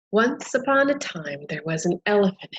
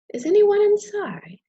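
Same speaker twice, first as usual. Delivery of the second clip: high, loud little voice at the door; a tiny caller knocking, high and bright; high butterfly voice; 11 semitones higher, 7 dB louder than usual. Acted. Butterfly knocking at the elephant's door, calling high and loud.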